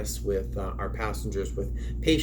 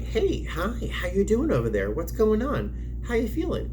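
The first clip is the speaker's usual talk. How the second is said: gentle check-in; 12 semitones higher than usual. Shown as a demonstration. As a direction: lift the voice way up and ask kindly. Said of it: "I'll kind of approach them slowly".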